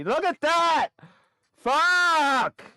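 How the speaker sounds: high, loud angry yell; furious shout. Real, not acted. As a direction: explode at the screen. Market drops after selling early.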